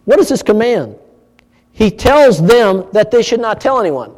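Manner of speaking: ordinary delivery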